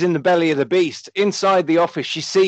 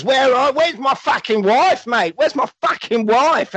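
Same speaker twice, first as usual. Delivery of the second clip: mock angry demand; 8 semitones higher than usual. Gary Daniels demands his wife from the villain, mock angry. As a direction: bark it like an action hero. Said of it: "Gary Daniels says".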